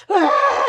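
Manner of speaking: high, loud recoiling "Ah"; shocked little yelp; disgusted gasp. Retold or imitated. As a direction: recoil at the chewing sound. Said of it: "you're just like".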